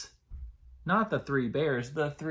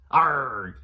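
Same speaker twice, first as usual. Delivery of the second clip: loud pirate growl Arg; 8 dB louder than usual. Shown as a demonstration. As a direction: bellow it like a pirate. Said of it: "Can you say arg like a pirate?".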